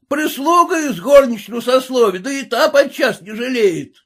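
high, jeering peasant rant; loud peasant abuse; scolding tirade. A narrator's reading. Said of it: "продолжал ругаться".

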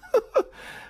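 high wild burst of laughter. Acted. The mad professor bursts out laughing, high and wild. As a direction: cackle like a madman. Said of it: "il folle scoppiò in una risata".

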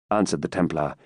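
plain reading voice

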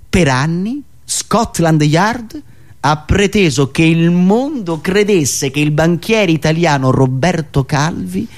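ordinary talk, nothing marked

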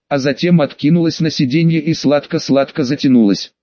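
usual speaking voice

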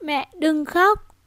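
put-on high, very slow, halting little girl; a mute child's first rusty words; dry child voice. Little girl comforting her crying mother, dry halting voice. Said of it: "thanh âm khô khốc".

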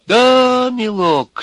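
put-on high-pitched little old-fellow croon; sympathetic drawl. A narrator's reading. Wizened little man pities the accused, sympathetic drawl. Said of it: "сочувственно протянул маленький сухонький человечек".

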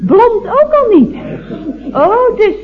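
high, startled oh of embarrassment; flustered surprise. Acted. Operator mortified on hearing the wife is not blond. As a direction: realize the blunder, mortified. Operator realizes her mistake.

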